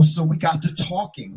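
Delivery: usual speaking voice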